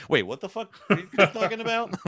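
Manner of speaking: high, startled mock outrage; confused soldiers. Soldiers realize the Elvish plan, mock startled disbelief. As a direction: squeak out baffled alarm. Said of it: "And they're like".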